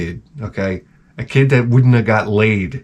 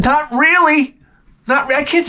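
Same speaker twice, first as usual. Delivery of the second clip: indignant; 14 semitones higher than usual. Retold or imitated. His reply.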